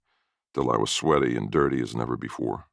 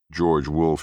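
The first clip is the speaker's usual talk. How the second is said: ordinary delivery, nothing marked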